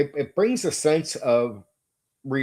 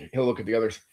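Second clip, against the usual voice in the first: normal talking voice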